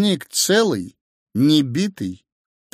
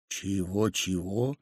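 soft, rising double question; quietly surprised; 5 semitones lower, 9 dB quieter, slower than usual. A narrator's reading. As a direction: ask softly in mild disbelief. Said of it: "удивилась супруга". (тихое удивление)

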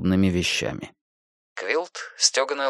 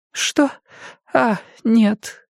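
высокий голос пожилой женщины; мягкая разговорчивая старушка; 13 semitones higher, much slower than usual. A narrator's reading. Jenny confused. (голос старушки)

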